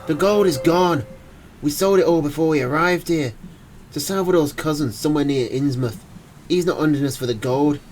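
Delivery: put-on Irish accent. Terrified Irishman warning that the hunter wants their souls. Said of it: "in a thick Irish accent".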